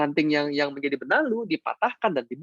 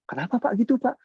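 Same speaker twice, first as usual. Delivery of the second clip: higher questioning member voice; a member asks why, pitched up; curious member; 6 semitones higher than usual. Retold or imitated. Member puzzled by the coach, higher questioning voice.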